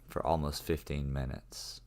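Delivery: ordinary delivery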